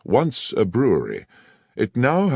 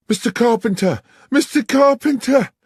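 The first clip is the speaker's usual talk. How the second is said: much higher, much louder panicked scream; frantic screaming; 7 dB louder than usual. Acted. Young woman bursts into the bar screaming for the landlord. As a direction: burst in screaming his name in terror. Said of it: "came screaming into the bar".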